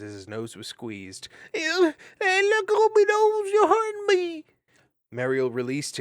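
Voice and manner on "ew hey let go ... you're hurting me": squealing hedgehog. Acted. Bowley's nose squeezed.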